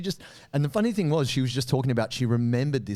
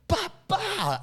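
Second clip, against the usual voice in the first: punch noises. Retold or imitated. Imitating punch sounds.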